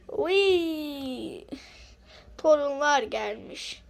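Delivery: usual speaking voice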